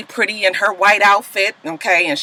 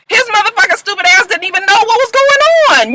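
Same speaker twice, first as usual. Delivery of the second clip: exasperated rant; 11 semitones higher than usual. Real, not acted. Ranting at the character.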